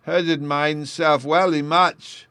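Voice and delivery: put-on high, loud, slow sobbing little boy; sobbing child. A narrator's reading. Bruno sobs to his sister about his hurt foot, mildly voiced. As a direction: wail it through the tears. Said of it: "sobbed the poor little fellow".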